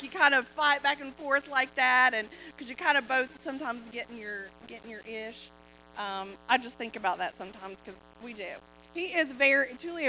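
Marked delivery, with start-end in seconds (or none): none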